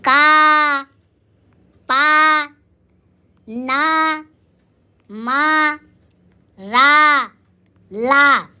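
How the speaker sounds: long, held आ vowel on का, पा, ना, मा, रा, ला; drawn-out syllables. Shown as a demonstration. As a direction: stretch each of का, पा, ना, मा, रा, ला on a steady open आ, one at a time. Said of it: "ध्यान से सुनना".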